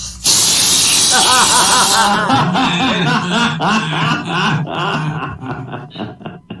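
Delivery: long wheezy laugh after the punchline; laughing at own joke. Real, not acted. Comedian to audience, real laughter. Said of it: "teller laughs at punchline".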